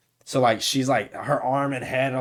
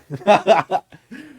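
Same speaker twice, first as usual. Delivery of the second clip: 1 loud high laugh; 8 semitones higher, 9 dB louder than usual. Real, not acted. Laughing after the quote.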